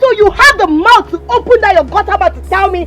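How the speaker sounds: loud, high-pitched indignation; outraged retort. Acted. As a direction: throw the insult back at a rival. Throws rival's words back in quarrel.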